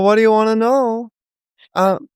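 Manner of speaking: normal talking voice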